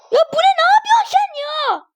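high-pitched, outraged protest; exasperated scolding. Real, not acted. Gamer to blundering teammate, exasperated scolding. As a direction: cry out at a useless teammate.

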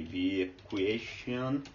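drawn out slowly; slow dictation. Shown as a demonstration. Teacher to viewers, slowly dictating the typed words. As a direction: say it slowly as you type. Dictating text while typing.

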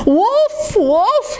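high, swooping mock alarm; cry of alarm. Jack scaring the birds, high alarm cry. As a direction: cry a false warning, high. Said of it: "he cried".

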